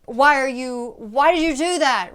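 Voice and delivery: tense, held-back anger, strong but not screamed; furious but keeping it down in a restaurant; an angry demo kept under control; contained anger. Shown as a demonstration. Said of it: "But it's not".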